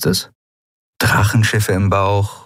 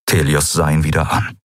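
plain reading voice

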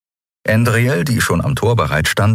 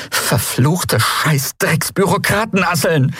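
high, slow, drawn-out curse; furious cursing; 12 semitones higher, slower than usual. A narrator's reading. Endriel enraged by an official letter, furious cursing. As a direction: spit out the compound insult in rage. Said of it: "Dann fing sie an zu fluchen".

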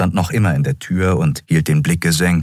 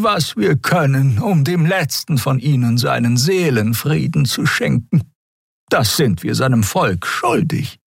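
resolute elder; 11 semitones higher than usual. A narrator's reading. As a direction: draw each word out in a high old voice. (bedächtige, hohe Altersstimme)

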